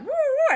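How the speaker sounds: high, very loud swooping woo; whooshing sound. Acted. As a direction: let out a big rising-and-falling whoosh. Magic strength whoosh sound effect.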